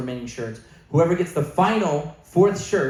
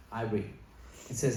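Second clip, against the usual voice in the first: ordinary talk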